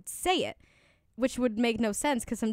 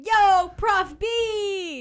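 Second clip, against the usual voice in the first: high, drawn-out, louder call-out; mock casual hail; 9 semitones higher, 6 dB louder, much slower than usual. Teasing co-host about emailing her professor, mock casual hail. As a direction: holler a teasing greeting, stretched long.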